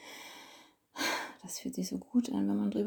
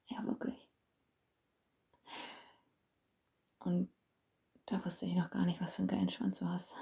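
hushed whisper; 3 dB quieter than usual. Acted. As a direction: breathe the words out. Holds a whisper.

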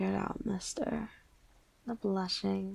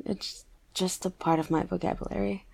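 ordinary talk

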